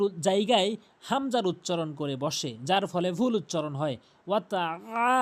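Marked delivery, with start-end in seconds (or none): 4.3-5.1 আ squeezed from the middle of the throat; throaty ayn. Shown as a demonstration. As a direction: stretch আ with a tight pharyngeal onset. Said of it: "হলকের মাঝখান থেকে".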